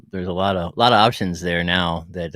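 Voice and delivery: ordinary talk, nothing marked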